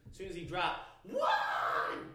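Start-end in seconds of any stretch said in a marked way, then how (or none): 1.0-2.1 mock yell of the count; shouted referee count. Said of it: "a lady in the background yelling out".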